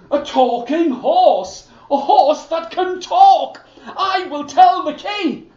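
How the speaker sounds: can't believe his ears; amazed soldier. Acted.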